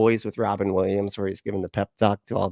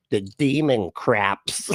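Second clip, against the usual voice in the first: slow, deliberate mock read-aloud; much slower than usual. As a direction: spell it out slowly as if reading a dumb post. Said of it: "and you read 'em, like".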